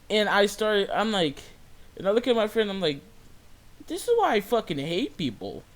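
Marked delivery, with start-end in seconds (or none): 3.9-5.6 higher-pitched, fed-up complaint; exasperated venting. Retold or imitated. Cashier complaining to friend about customer, exasperated.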